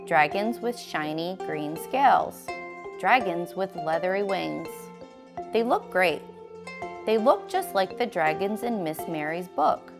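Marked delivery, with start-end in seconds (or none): none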